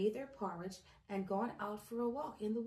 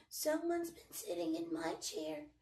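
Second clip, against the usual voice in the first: high little cub voice; tiny Baby Bear voice; 7 semitones higher than usual. A narrator's reading. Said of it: "he cried".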